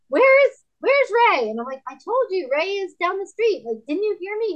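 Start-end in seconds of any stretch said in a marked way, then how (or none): 0.1-1.5 high, loud mock calling out; comic raised voice, pitched way up; hard-of-hearing husband. Husband who can't hear, acted out. 1.9-4.5 high-pitched mock bickering; comic exasperation at not being heard; exasperated wife. Acted bickering with husband.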